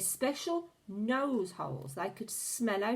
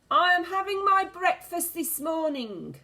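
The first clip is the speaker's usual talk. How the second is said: high, loud witch voice; witch crying out; 11 semitones higher, 7 dB louder than usual. A narrator's reading. The Grand High Witch rants to the witches, crying out. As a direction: complain like a furious old witch. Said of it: "cried the Grand High Witch".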